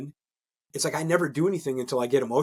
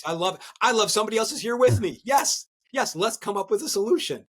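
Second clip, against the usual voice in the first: excited welcome; 7 semitones higher than usual. Retold or imitated. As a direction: cheer her in like a party host. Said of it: "I said".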